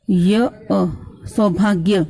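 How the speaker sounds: usual speaking voice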